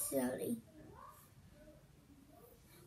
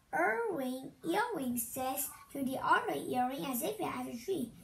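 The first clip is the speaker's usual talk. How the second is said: normal talking voice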